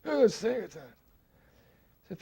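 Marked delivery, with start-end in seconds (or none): 0.0-0.8 much higher and louder, eager; loud high eager insistence; Pigpen's eager voice. Retold or imitated. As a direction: blurt it out high and keen.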